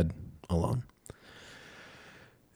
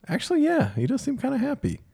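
mock surprised. Retelling own surprised answer.

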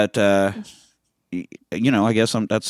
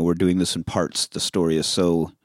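ordinary talk

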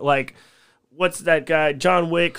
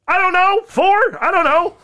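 much higher, louder flustered squeak; flustered panic; 7 dB louder than usual. Retold or imitated. Drunk driver answers the police, high and panicky. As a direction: blurt it out, caught off guard. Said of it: "I'm like".

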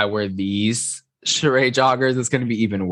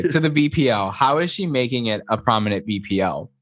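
ordinary talk, nothing marked

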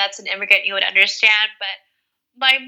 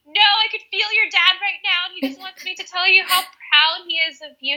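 high, pleading reassurance to mom; retold tearful insistence, pitched up; emotional retelling; 9 semitones higher than usual. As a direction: replay an emotional message to your mother.

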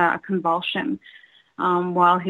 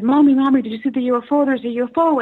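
put-on higher, louder child's call for mom; excited kids calling mommy; 5 semitones higher, 4 dB louder, faster than usual. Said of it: "we said".

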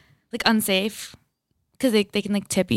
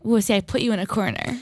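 usual speaking voice

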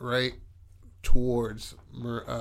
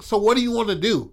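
retold challenge; 8 semitones higher than usual. Acted challenge in retold quarrel.

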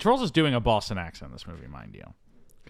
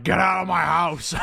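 slow, raised mock bellow; hammed-up angry shout; 8 semitones higher, 6 dB louder than usual. Plummer throwing his son out, comic angry shout. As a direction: bellow it theatrically, half laughing.

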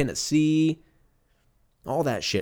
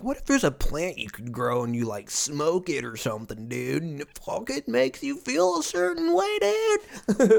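awed stoner climbing to a very high squeal; mock stoner; 6 semitones higher than usual. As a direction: build from musing to squeaky mind-blown parody. Mocks own stoned big idea.

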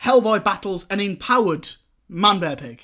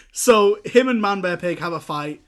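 ordinary delivery

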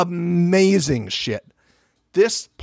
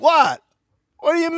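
surprised; 14 semitones higher than usual. Retold or imitated. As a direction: squeal it like a shocked guest. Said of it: "She's like".

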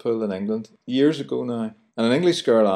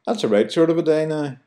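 higher, chirpy Belfast remark; Belfast chat; 7 semitones higher than usual. Shown as a demonstration. Host demoing Belfast phrase to listeners, higher cheerful voice. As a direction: beam it out Belfast style. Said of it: "we'll say".

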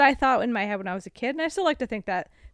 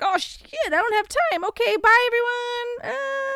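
high, rushed panicky goodbye; hurried Dorothy; 11 semitones higher than usual. Retold or imitated. Retelling Dorothy fleeing Oz, high flustered goodbye. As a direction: squeak it out in a flustered rush. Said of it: "Dorothy's like".

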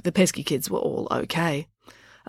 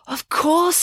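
high bright exclamation; exclaimed; 11 semitones higher than usual. A narrator's reading. Loretta exclaims as the truth dawns. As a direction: pounce on the realisation. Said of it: "exclaimed Loretta".

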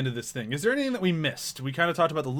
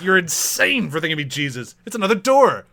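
mock offended; 3 semitones higher, 5 dB louder than usual. Co-host as minister scolding a wrong guess, mock offended. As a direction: build an affronted correction to a raised finish. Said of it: "he's so offended at her guessing the wrong answer".

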